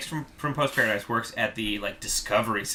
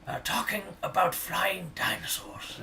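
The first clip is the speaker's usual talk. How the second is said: nature documentary narrator; 2 semitones higher, 4 dB quieter than usual. Pretend. David Attenborough narrator impression.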